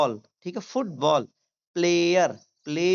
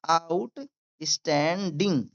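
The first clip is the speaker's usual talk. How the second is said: "Outstanding" slowly, out, stan, ding; syllable by syllable; slower than usual. Shown as a demonstration. Teacher demonstrating to learners, syllable by syllable. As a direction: break "outstanding" into separate pieces. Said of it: "मेरे साथ भी बोलिए".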